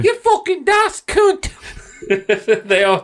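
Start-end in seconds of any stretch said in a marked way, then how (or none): 0.0-1.5 high squawking British insult; cartoon British name-calling; mock British. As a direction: hurl it in a falsetto British accent. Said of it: "how liberal they are".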